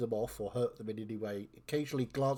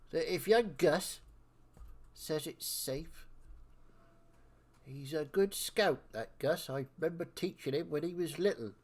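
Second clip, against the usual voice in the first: higher, louder voice of a reckoning elder; chatty old-timer character; 5 semitones higher, 6 dB louder than usual. Acted. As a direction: play the know-it-all elder, punching phrases. Game master voices elder character.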